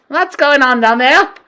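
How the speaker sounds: high, loud demand from above; witch queen shouting. Acted. Grand High Witch shouting at the hall, high and loud. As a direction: bark down from the platform. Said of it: "shouted the Grand High Witch".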